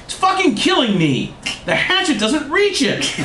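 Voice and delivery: very high, whiny-comic plea; hammed-up high-pitched begging; imploring. A narrator's reading. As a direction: plead desperately way up in pitch. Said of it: "then he implored them".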